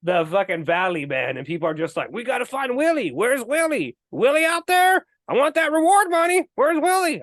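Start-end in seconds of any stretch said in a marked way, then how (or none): 2.1-7.2 high, hammed-up country accent; mock frantic townsperson with a country accent; country townsfolk accent. Townspeople hunting Willie for reward money, hammed-up country accent. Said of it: "I don't know why I'm doing that voice".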